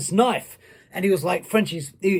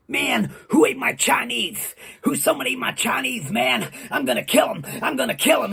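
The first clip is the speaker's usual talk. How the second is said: high, put-on voice, all fired up; hammed-up angry character, pitched up; irate wrestler voice; 7 semitones higher than usual. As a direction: imitate an enraged wrestler making threats. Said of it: "I used to do the voice well".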